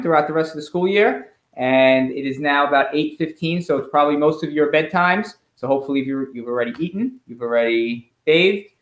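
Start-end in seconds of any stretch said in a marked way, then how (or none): none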